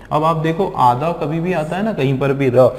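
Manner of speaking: ordinary delivery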